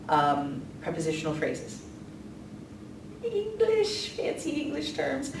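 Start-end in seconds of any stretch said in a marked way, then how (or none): 3.2-5.3 much higher, mock posh squeak on "English"; jokingly lofty, high-pitched aside; mock fancy voice. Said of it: "mock fancy voice".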